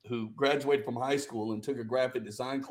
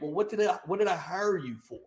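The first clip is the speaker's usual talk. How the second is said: frustrated; 6 semitones higher than usual. Retold or imitated. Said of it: "I remember being so frustrated".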